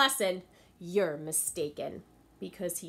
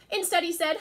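lively, raised storytelling voice for the class; story narration; 10 semitones higher, 7 dB louder, faster than usual. A narrator's reading. Teacher reading a story to her class, animated. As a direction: read it to the kids with big expression.

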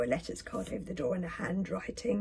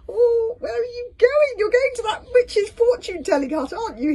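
very high, loud, frantic squeal; panicky hiss; 11 dB louder than usual. A narrator's reading. Fishlegs challenging Hiccup while running after him, panicky. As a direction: squeal it in alarm. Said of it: "hissed Fishlegs".

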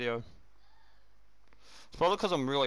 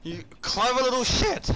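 high-pitched exclamation; 10 semitones higher, 4 dB louder, slower than usual. Real, not acted. Repeats the insult, stretched out.

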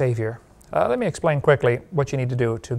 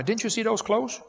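mock scolding mom; 7 semitones higher than usual. Mom scolds kid about clothes.

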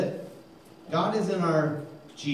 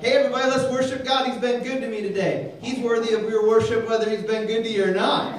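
high, loud, rushing hype; rally everyone, fast and bright; eager worship call; 7 semitones higher, 4 dB louder, faster than usual. Retold or imitated. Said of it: "you hear people say".

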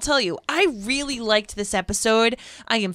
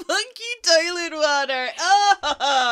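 laughing; 7 semitones higher, slower than usual. Real, not acted. Laughing at son's toilet joke.

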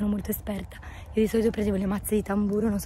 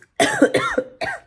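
harsh cough; 9 dB louder than usual. Real, not acted. Sick, losing voice.

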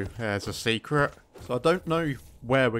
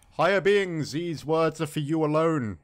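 dramatic reading; 4 semitones higher, 3 dB louder than usual. Acted. Reading ancient lore tablet aloud.

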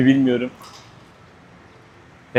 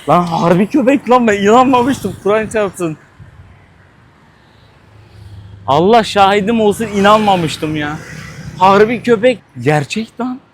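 can't believe what he's seeing, let it burst out; shocked outburst; 5 semitones higher than usual. Real, not acted. Vlogger to viewers, stunned by dog barbecue, excited outburst.

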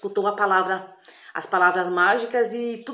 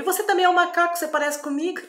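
put-on higher, dazed boy's voice; confused boy after the potion, higher voice; boy who thinks he's a monkey; 10 semitones higher, 4 dB louder than usual. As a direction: insist you're a monkey, pitched up.

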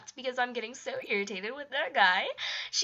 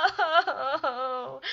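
dismayed cry then laugh; 2 semitones higher, 4 dB louder than usual. Real, not acted. Nobody home at Dan's.

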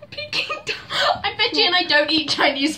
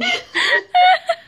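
high squeaky laugh; 8 dB louder than usual. Pretend. Animal impressions, squeaky laugh.

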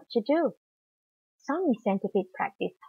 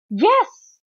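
high, loud eager shout; short rousing yell; team shout; 10 semitones higher, 8 dB louder than usual. A narrator's reading. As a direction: shout back as the whole team. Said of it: "both the teams shouted".

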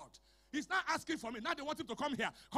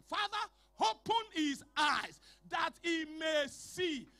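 loud, shouted declaration; preaching at full force; 5 dB louder than usual. Acted. Pastor decreeing over the congregation, loud shouted preaching. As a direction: thunder the blessing over them.

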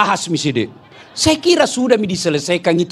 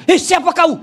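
high, louder startled cry; 9 semitones higher, 3 dB louder than usual. Retold or imitated. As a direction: jump in fright at the creature. Husband startled by the jinn.

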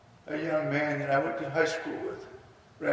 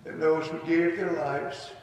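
prayer. Real, not acted. Pastor praying at a memorial ceremony, reverent. Prays for veterans.

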